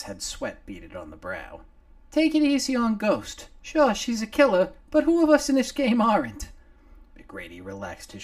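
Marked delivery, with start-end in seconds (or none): 2.1-6.5 much higher, louder tiny-man voice; a little face on a shoulder gulping fresh air; little Nomar. Acted. Nomar freed from under the clothing, high and loud.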